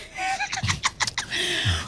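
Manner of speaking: high burst of laughter. Real, not acted. Host laughing aloud at a listener's text message, bright laugh.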